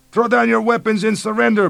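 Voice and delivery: put-on high, loud bellow with Mexican accent; shouting. A narrator's reading. Police chief bellowing at American invaders, loud shout. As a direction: bellow it from the jungle. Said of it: "Barreo continued to bellow".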